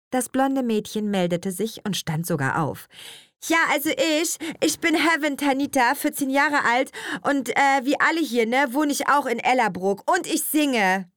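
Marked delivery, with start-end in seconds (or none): 3.4-11.1 put-on high-pitched teenage girl, full of herself; higher, self-important girl's voice; self-important schoolgirl. A narrator's reading. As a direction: announce it like big news. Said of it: "verkündete sie gewichtig".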